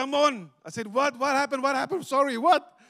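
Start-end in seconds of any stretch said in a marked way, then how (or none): 0.0-0.5 excited call. Retold or imitated. Friend bursts out with news. 0.9-2.8 higher, louder startled questions. Retold or imitated. Startled by friend's hug.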